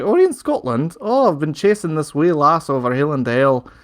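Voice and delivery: put-on lilting Scottish accent. The Doctor seizing an excuse to talk in a Scottish accent. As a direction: put on a playful Scottish brogue. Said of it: "starts speaking in his, in his Scottish accent".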